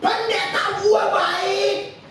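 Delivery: hammed-up gushing, high and very slow; mock gushing praise. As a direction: stretch out the praise like a starry-eyed fan. Jemaat memuji pendeta, berlebihan. (jemaat memuji pendetanya dengan suara tinggi dan sangat lambat)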